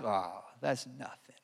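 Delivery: very soft, low, belittling; imitated dismissive devil. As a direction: drop your voice right down to belittle him. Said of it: "the devil started whispering in my ear".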